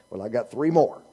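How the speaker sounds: ordinary delivery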